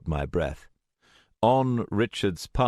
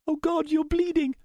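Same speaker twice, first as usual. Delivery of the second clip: very high distressed voice; horrified, high-pitched alarm; panicked horror. A narrator's reading. Panics over wounded friend.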